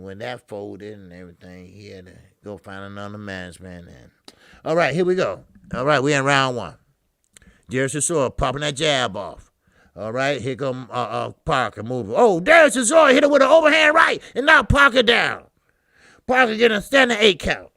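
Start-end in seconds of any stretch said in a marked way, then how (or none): 4.6-12.1 loud, excited ringside call; shouting the knockdown at the mic; live play-by-play. Acted. 12.2-17.7 high, loud knockdown call; roaring the knockdown call; excited shout. Real, not acted. Calling the knockdown.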